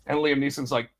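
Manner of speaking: normal talking voice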